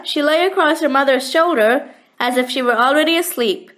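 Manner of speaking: ordinary delivery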